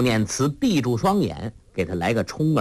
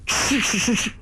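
quick high swishing sound; knife swishes; 11 semitones higher than usual. A narrator's reading. Narrator voicing the blade swishing past her cheek. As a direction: mimic the knife flicking past.

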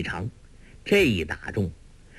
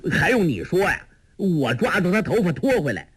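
higher-pitched, mildly irritated comeback; impatient retort; 7 semitones higher than usual. A narrator's reading. Sha Tongtian answers his junior's warning, impatient retort.

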